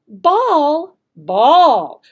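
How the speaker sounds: first ball rising slowly, then a loud falling ball; varied pitch. Shown as a demonstration. Teacher demoing the voice variation, loud and swooping. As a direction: contrast the tune between the two balls. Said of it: "when you use it that way".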